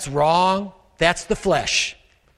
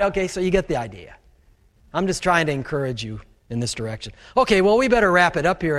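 usual speaking voice

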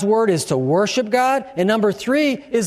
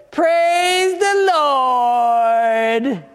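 neighbors calling out; 5 semitones higher, much slower than usual. Retold or imitated. Neighbors calling to the passing car, drawn out. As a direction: stretch it out like a greeting called from a porch. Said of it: "they said".

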